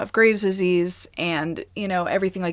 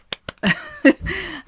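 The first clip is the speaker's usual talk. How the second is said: imitated laugh; 11 semitones higher than usual. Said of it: "And he's like".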